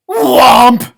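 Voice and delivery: booming shouted whomp; big cartoon whomp; loud whomp sound. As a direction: land the whomp hard like a thud. Sound effect word in story.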